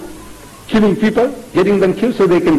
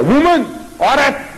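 sharp, higher-pitched address, falling away; acted rebuke; 7 semitones higher than usual. Son addressing his mother at the wedding, acted rebuke. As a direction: snap it out as a mock rebuke. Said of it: "He's telling his mother".

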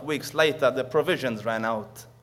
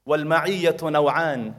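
quick Arabic recital; 3 dB louder, much faster than usual. Acted. Arabic phrase rapid.

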